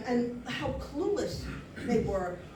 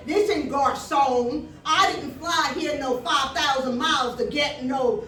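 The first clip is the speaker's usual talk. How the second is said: loud, drawn-out complaint to a waiter; indignant tourist; 6 dB louder, slower than usual. Retold or imitated. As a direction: play a loud, demanding tourist. Acting out rude tourists to waiter.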